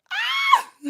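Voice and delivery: very high shriek; scared shriek. Real, not acted. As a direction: scream in fright. Shriek at mouse.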